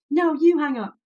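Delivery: high cooing lovers' tease; mock lovey-dovey. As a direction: coo it like a smitten teen on the phone. Mocking lovesick couple on phone.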